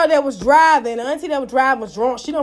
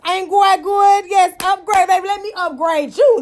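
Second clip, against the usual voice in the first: joyful praise shout; 6 semitones higher than usual. Real, not acted. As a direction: shout your thanks up high.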